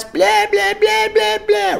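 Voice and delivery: very high screeched blahs; mock screaming. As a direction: mimic a screaming rant in nonsense. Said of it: "I start yelling and screaming".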